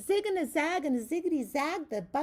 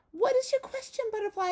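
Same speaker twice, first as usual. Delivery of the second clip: little lamb voice; 11 semitones higher, 7 dB louder than usual. Acted. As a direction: ask it wide-eyed like a lamb. Lamb voiced in a read-aloud.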